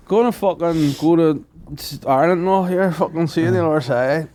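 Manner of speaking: half-cut pilot. Retold or imitated. Co-host imitating a half-cut pilot, mock drunk voice. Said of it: "the boy's half cut".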